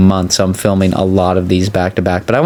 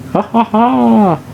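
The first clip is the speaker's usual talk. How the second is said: slow, high hammed-up villain laugh; mock evil laugh; 13 semitones higher, much slower than usual. Streamer ends his villain impression with mock evil laugh. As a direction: cackle like a cartoon schemer.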